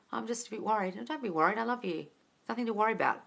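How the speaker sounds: soft, lightly lifted voice; gentle reassurance. Shown as a demonstration. Coach models the revised breakup scene, softly reassuring. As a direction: soothe a partner softly. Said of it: "That's how you'd advise it".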